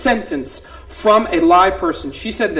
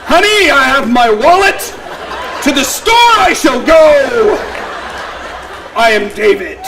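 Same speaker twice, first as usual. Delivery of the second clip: louder, higher, hammed-up pompous dad; pompous mock dad; 5 semitones higher, 6 dB louder than usual. Mocking how daughter imitates him, pompous and loud. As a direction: ham up a grand pompous husband. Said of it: "Never in my life have I said that sentence".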